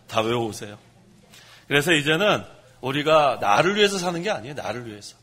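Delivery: normal talking voice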